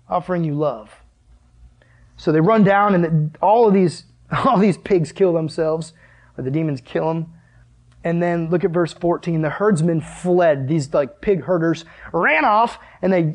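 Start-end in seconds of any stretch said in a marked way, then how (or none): none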